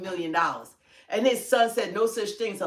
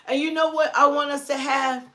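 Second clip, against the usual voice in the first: usual speaking voice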